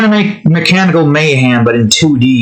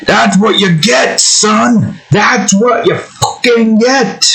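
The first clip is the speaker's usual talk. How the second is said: high, drawn-out taunting yell; high-pitched triumphant mock yell, without much volume; 8 semitones higher, slower than usual. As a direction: crow it at a beaten enemy. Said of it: "a yelling".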